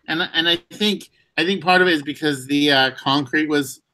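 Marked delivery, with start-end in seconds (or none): none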